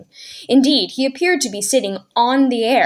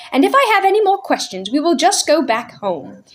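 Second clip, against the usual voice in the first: lightly voiced prim nanny, a touch higher; crisp, slightly raised governess voice; prim Mary Poppins; 7 semitones higher than usual. A narrator's reading. Nanny to the children, a lightly prim voice.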